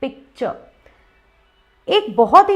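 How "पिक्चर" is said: पिक्चर soft, no final R; softly modelled "pik-chuh" for पिक्चर; gentle pronunciation. Shown as a demonstration. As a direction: ease off on पिक्चर, ending "chuh". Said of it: "बहुत ही gentle तरीके से कहना है".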